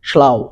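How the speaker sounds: "স্লাউ" with au as in "ow"; model pronunciation. Shown as a demonstration. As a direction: model "স্লাউ" clearly with an ow glide. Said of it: "আউ এর মতন উচ্চারণ হবে".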